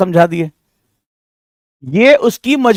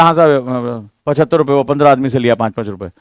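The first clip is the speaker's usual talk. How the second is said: low, fading humble answer; the bully turned polite, softly; meek goon; 7 semitones lower, 3 dB quieter than usual. Retold or imitated. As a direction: troublemaker meekly telling the teacher the amount. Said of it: "बोला".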